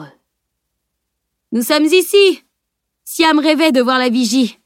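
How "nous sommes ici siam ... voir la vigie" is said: higher, raised voice from up above; calling out. A narrator's reading. Mathieu calls from the top of the steps, ringing out. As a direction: call down brightly to the others. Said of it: "La voix de Mathieu retentit, provenant du haut".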